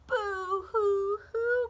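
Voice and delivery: high, loud, slow hammed-up sobbing; slow, loud mock boo-hoo sobs in a high bird voice; boo-hoo crying. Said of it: "cried Bird".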